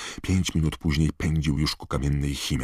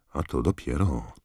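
ordinary delivery

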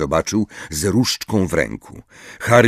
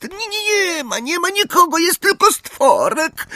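very high creature voice, slowing at the end; boastful house-elf. A narrator's reading. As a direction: brag in a squeaky elf voice. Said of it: "oznajmił chełpliwie skrzat". (chełpliwy, bardzo wysoki głos skrzata)

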